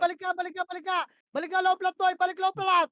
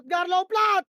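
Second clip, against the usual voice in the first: louder, pressing repeat of the call; urgent callout; 7 dB louder, much slower than usual. Real, not acted. Shotcaller urging teammate Gar, louder urgent call. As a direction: push the order harder.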